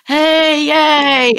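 high, loud, drawn-out cheering; imitated group cheer. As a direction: cheer like colleagues celebrating a prank. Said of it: "everyone starts laughing around me".

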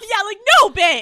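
high and loud, scoffing no bitch; loud mocking dismissal of pampered men; loud dismissive. Real, not acted. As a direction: shout the brush-off with a scoff.

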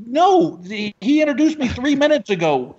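loud high-pitched objection; exasperated protest. Retold or imitated. Introduced comic to tech guy, high exasperated protest. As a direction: burst out high, starting loud.

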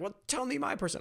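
eager request. Retold or imitated. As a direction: ask it like you can't wait your turn. Next chief eagerly asking.

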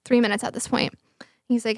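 ordinary talk

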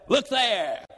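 high, pointing quick deflection; look over there. Retold or imitated. Caught with a hand in the cookie jar, deflecting blame with a high pointing call. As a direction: throw the blame elsewhere, high and light.

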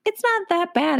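high-pitched indignant protest; retold protest. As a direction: squeak out a mock defensive reply. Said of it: "And I was like".

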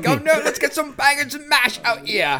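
put-on high, loud Cockney barrow-boy call; hammed-up Cockney shout for bangers and mash; Cockney accent. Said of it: "they're not Cockney".

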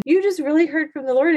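high-pitched retold wonder; amazed. Mom tells her daughter what just happened, amazed. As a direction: tell the child in astonishment, pitch well up.

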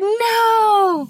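high, loud, drawn-out NO; frustrated shout. Real, not acted. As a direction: wail it in comic dismay at your own mistake. Host protests own flubbed take.

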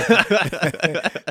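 a big burst of laughter at the question; open-throated belly laugh; hearty laugh. Retold or imitated. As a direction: laugh out loud, warmly amused. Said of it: "So he laughed".

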